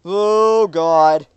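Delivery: loud, high groan of alarm; alarmed exclamation. Real, not acted. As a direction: blurt it out in alarm. Near collision reaction.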